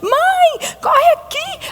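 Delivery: put-on high-pitched child's cry for mom; girl yelling for mom. Karen calling her mother in alarm, high shrill cry. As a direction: shriek for mom in a panic. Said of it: "Ela começou a gritar pra mãe dela".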